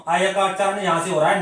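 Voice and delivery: ordinary talk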